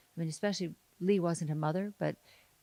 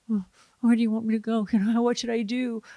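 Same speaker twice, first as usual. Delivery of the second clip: louder, higher, quick questions to the director; eager young actor; 4 semitones higher, 8 dB louder than usual. Retold or imitated. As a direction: fire off anxious questions, raised voice. Acting out her young, unsure self.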